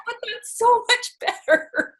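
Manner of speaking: high, slow, giggling protest; laughing delight. Retold or imitated. Coach laughingly defends the toddler's words to dad. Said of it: "I'm like".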